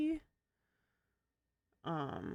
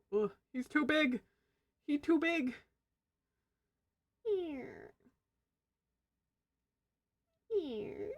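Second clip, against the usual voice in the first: put-on high whiny baby talk; 8 semitones higher, 4 dB louder, slower than usual. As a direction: whine playfully about size. Struggling with a big picture.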